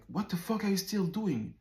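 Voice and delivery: high-pitched irritated demand; exasperated high-pitched quote of him; imitated yelling. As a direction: act out the friend's angry challenge. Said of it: "that's when he started yelling".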